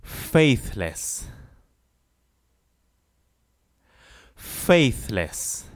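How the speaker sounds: "Faithless" said slowly with clear th; model pronunciation. Shown as a demonstration. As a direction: pronounce "Faithless" carefully. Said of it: "practice with me the pronunciation".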